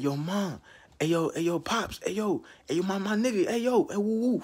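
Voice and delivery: high, rising excited calls; coming home rich, calling to mom and pops, excited. Retold or imitated.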